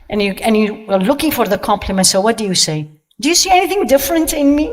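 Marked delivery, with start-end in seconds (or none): 3.2-4.7 high, quick, hopeful question; mock fishing for praise, pitched up and hurried; fishing for compliments. Woman prompting others to notice her new look, fishing for compliments.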